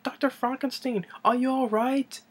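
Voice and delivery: high imitated female character voice; Inga. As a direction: imitate a concerned assistant, pitched high. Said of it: "And then Inga is like".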